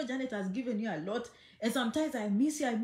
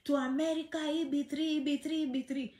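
higher, softer mock gossip voice; mocking gossipers; 4 semitones higher, 3 dB quieter than usual. Vlogger mimics people spreading a rumour, mock gossip voice. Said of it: "I've been hearing people saying".

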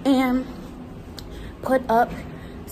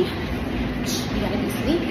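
soft slow unvoiced whisper; 3 semitones lower, 4 dB quieter than usual. Shown as a demonstration. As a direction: whisper it like sneaking.

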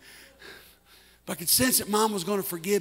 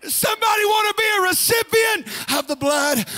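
loud, high, chanted sermon cadence; fiery preaching; 5 semitones higher, 3 dB louder than usual. Acted. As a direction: preach it hard to the congregation. Preaching at full force.

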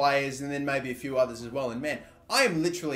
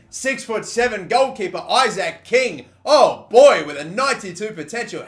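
higher, louder hype; excited; 5 semitones higher, 9 dB louder than usual. Real, not acted. Gamer hyping a scouted goalkeeper, excited. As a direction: sell the discovery with glee. Finds star youth keeper.

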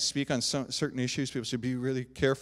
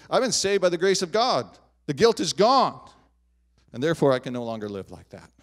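louder and higher, ringing out; bold proclamation; 4 semitones higher, 8 dB louder than usual. Acted. Preacher voicing conviction to the congregation, loud and triumphant. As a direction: proclaim it like a declaration of victory.

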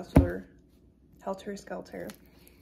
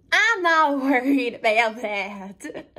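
slow, raised reply with a laugh; brazen defiance; 4 semitones higher, 3 dB louder, slower than usual. Acted. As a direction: laugh off the order, drawing it out. Defies order to keep voice down.

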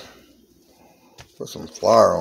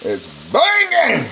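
excited; 6 dB louder, slower than usual. Real, not acted. Excited about food.

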